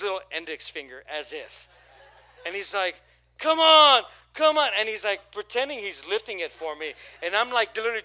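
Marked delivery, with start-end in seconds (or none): 3.4-4.7 high, loud come on; loud trainer urging. Retold or imitated. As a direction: shout him through the last rep.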